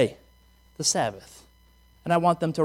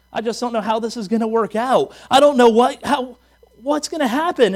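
rising panic; 5 semitones higher, 6 dB louder than usual. Retold or imitated. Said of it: "somebody else going".

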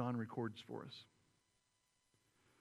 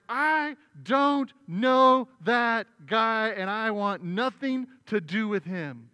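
slow, loud furious denial, much higher; angry shout; 6 semitones higher, 13 dB louder, much slower than usual. Retold or imitated. Peter screaming at the crowd, furious and slow. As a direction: bellow the denial, drawn out. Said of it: "curses and screams out".

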